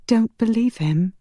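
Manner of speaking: high, trembling plea; pleading. Acted. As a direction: warn him urgently, pitched high. Trembling heroine warns Connor.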